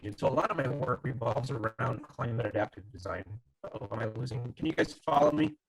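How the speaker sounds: choppy robotic call audio; glitchy robot sound over a bad connection. Real, not acted. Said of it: "you sound like a robot".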